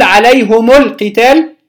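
عليهم القتال joined as "alayhumu l-qital"; short u linking عليهم to القتال; damma without sila. Shown as a demonstration. Teacher showing students the linking, عليهم القتال as "alayhumu l-qital". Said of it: "بضم الميم بغير صلة".

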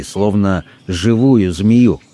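ordinary delivery, nothing marked

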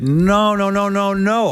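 panicked husband's denial; frantic high denial. Retold or imitated. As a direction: protest hard and high.

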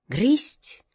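plain reading voice

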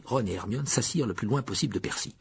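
plain reading voice

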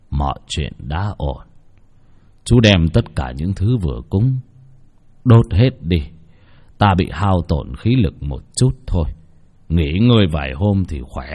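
barely able to speak after the ritual; tired reassuring elder; weak old man. Acted. Exhausted old man reassures, weakened.

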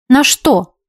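ordinary delivery

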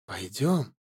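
hushed and low beckoning; quiet coaxing whisper-soft call. Acted. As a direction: draw him in gently. Spirit luring him softly. (тихо, вкрадчиво)

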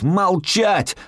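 high-pitched yelled command; yelling. A narrator's reading. Gunman orders hostages to shut up, yelling. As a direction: bark it out to silence a room. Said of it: "ору".

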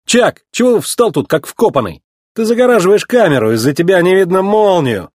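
high, indignant scolding; reproachful. A narrator's reading. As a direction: chide someone who is in your way. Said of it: "упрекнул его Маккуин".

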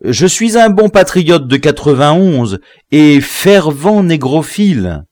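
loud, high-pitched declaration; emphatic, high protestation; fervent protest. A narrator's reading. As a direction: plead loyalty at the top of the voice. Prisoner protests loyalty under threat.

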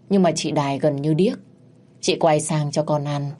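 usual speaking voice

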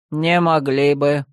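low, slow contradiction; lightly voiced frost tsar, measured and low; Karachun. A narrator's reading. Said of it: "опроверг Карачун".

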